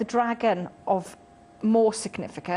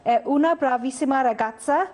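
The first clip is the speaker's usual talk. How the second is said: put-on Italian accent, slightly raised; 3 semitones higher, 3 dB louder than usual. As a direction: read it in a hammy Italian accent. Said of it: "my best Italian accent".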